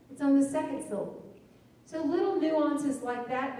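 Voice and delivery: ordinary talk, nothing marked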